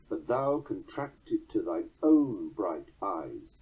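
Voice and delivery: slow, own hit firmly after three light beats; stress on own. Shown as a demonstration. Lecturer showing the beat falling on own, stress on own. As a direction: skim to thine, then hammer own. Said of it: "has all the more emphasis".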